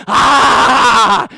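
long, loud "aah" of gloating; triumphant mock yell. Dad proves his five-year-old wrong, gloating yell. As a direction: yell in comic vindication.

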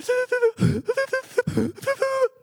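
long, high, hammed-up hitching sobs; imitated childhood crying. Shown as a demonstration. Young pitcher in the dugout, exaggerated stuttering sobs. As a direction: imitate uncontrollable juddering sobs. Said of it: "that was me in the dugout".

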